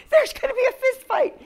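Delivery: high, loud retold exclamation; mock alarm. Wife retelling her husband's reaction to the quarrel, mock alarmed. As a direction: imitate a husband bracing for a brawl.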